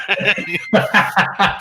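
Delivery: bright laughing fit. Real, not acted. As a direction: laugh out at the joke. Laughing at software joke.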